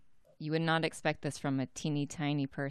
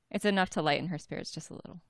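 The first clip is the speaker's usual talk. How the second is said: ordinary delivery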